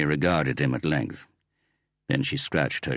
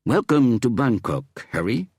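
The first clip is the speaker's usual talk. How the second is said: beaming greeting; 7 semitones higher, 4 dB louder than usual. A narrator's reading. Police chief greets Harry.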